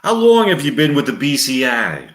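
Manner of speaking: a lightly done New York cop; New York accent. Acted. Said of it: "with a New York accent, male voice".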